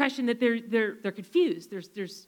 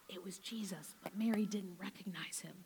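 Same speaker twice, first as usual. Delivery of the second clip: hushed, breathy storyteller's whisper; 6 semitones lower, 16 dB quieter than usual. Acted. Preacher whispers the reveal to the congregation, hushed.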